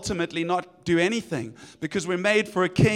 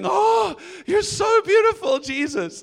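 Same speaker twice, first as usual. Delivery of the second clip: high, loud adoring cry; overwhelmed with love; rapturous worship; 7 semitones higher, 4 dB louder than usual. Retold or imitated. Worshippers cry out to Jesus in rapture. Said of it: "we're just going".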